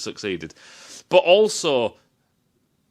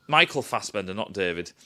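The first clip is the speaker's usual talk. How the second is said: ordinary talk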